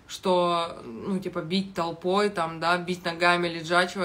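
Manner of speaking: usual speaking voice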